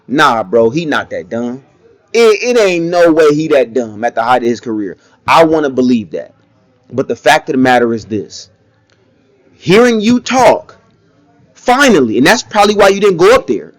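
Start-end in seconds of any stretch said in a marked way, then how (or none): none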